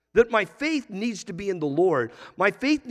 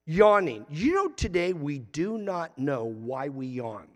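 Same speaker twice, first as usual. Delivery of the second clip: normal talking voice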